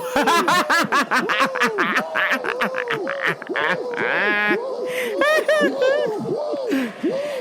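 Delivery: long high-pitched cackling laugh; helpless, drawn-out laughter; laughing fit. Real, not acted. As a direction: lose it at your own joke. Cracking up at own joke.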